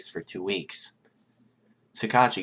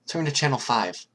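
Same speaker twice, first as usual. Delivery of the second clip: urgent worry; 7 semitones higher, 4 dB louder than usual. Acted. Nezu urgent on phone.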